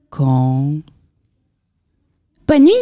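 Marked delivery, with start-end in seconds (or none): 2.5-2.7 little mouse voice; mouse slipping in a goodnight. A narrator's reading. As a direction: slip in a quick squeaky goodnight. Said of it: "glissa la souris".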